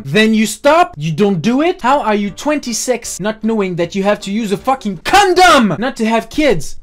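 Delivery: exasperated scolding. Retold or imitated. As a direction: scold in disbelief, exploding on the swear word. Scolding friend over no condom.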